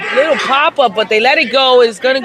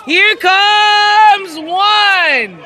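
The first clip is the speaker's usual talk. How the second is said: slow, drawn-out shouted call; excited call; 6 semitones higher, much slower than usual. Real, not acted. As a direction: stretch it out like a stadium announcer. Calling the play live.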